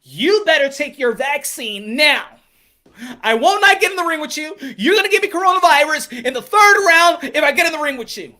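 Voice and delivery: high, loud, indignant mock fighter; mocking Charlo impression. Mocking the fighter who demands vaccines, high loud indignation. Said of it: "And people be like".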